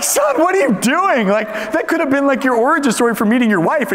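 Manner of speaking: very high-pitched mock outrage; hammed-up, incredulous scolding of a son; exasperated father. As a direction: squeal it in comic disbelief.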